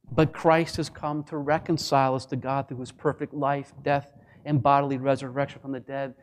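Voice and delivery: ordinary talk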